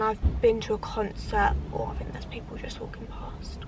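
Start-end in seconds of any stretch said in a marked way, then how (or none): 1.7-3.6 hushed unvoiced whisper. Real, not acted. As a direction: drop to a whisper as strangers pass. People walking past, speaker hushes.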